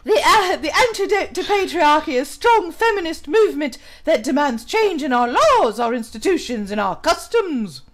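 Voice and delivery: put-on English accent, reading aloud. Streamer reading an article on patriarchy aloud, English accent. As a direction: read it out in a mock English accent. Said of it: "when I'm in my English accent".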